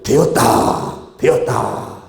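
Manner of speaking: proclaimed voice from heaven. Acted. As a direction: announce it as from heaven, endings drawn out. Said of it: "하늘에서 소리가 들리는데". (하늘에서 선포하는 소리)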